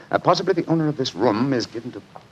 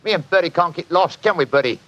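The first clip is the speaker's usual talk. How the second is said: higher-pitched put-on Cockney workman; Cockney accent; 7 semitones higher than usual. Bluffing the landlady as a council inspector, Cockney accent. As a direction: play a chatty Cockney building inspector. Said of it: "My Cockney accent".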